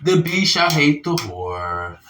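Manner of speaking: usual speaking voice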